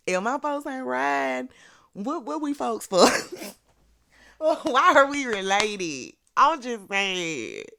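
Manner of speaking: high, loud, incredulous outburst; worked-up loyalty rant. Real, not acted. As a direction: plead your case at the top of your pitch. Comic outburst about loyal folks.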